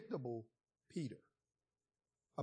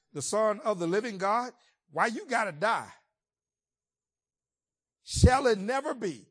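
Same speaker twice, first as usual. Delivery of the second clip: louder, rising indignant pushback; indignant protest; 2 semitones higher, 6 dB louder than usual. Retold or imitated. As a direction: argue back in disbelief. Peter protesting Jesus' death.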